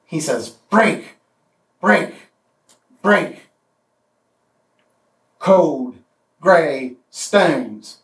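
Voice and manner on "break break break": each "break" struck hard and high with a falling end; slow, heavy "break, break, break"; hammered words. Shown as a demonstration. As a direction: bring "break" down like a hammer, three times. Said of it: "hammers coming down".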